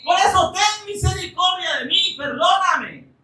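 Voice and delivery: súplica aguda y fervorosa; clamor suplicante. Retold or imitated. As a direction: clama creyendo con todo el corazón. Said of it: "Él estaba diciendo". (clamor agudo y ferviente)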